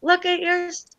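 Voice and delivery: high imitation of an animated heroine; lilting high mock-Ariel line; Little Mermaid. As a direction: do Ariel from the song, high and light. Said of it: "and she goes".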